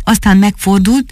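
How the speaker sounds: ordinary delivery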